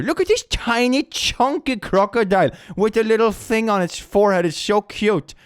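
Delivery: high excited gushing. Real, not acted. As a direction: squeal over something cute. Gushing over cute crocodile photo.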